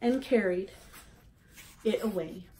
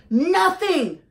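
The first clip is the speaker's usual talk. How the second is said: high, loud squawk of an upset gosling; 11 semitones higher, 12 dB louder than usual. A narrator's reading. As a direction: shriek it out like an angry young goose. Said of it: "he squawked".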